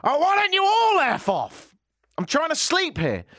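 high, loud hammed-up shout; half-mock furious shout; mock angry yell. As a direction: yell at them from the doorstep. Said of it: "I went over and said".

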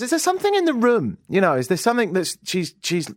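sister's voice rising high and anxious; worried sister. Retold or imitated. Sister on the phone asks what baby sees, high and worried. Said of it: "my sister says".

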